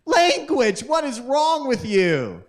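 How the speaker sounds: high, shocked scolding mother, slowing on the last words; scolding mom. Retold or imitated. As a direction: play the mom who is scandalised by swearing. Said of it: "my mom goes".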